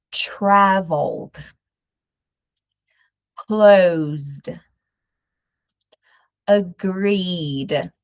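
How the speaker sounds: each word ending in a plain D, no E sound; past tense, no extra syllable. Shown as a demonstration. Teacher modelling past-tense words for students. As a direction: say traveld, closd, agreed. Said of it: "No extra syllable".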